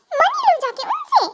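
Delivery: high, slow parroting "money लो जाके"; mocking echo. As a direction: throw the friend's words back in disbelief. (नकल उतारते हुए ताना)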